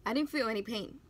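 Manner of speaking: usual speaking voice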